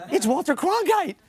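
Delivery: high, excited retold exclamation; acted-out amazement; starstruck outburst. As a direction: blurt it out starstruck, pitch jumping up. Retold starstruck reaction to phone call.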